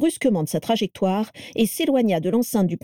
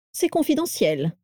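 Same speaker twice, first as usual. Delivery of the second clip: annoyed; 6 semitones higher than usual. A narrator's reading. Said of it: "répondit Lucius avec agacement".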